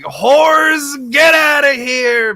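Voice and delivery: high, drawn-out mock bellow; Dracula's imitated scream. Vampire ordering the concubines out, mock scream. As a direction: imitate an outraged shout, stretching the words. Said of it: "he's just screaming".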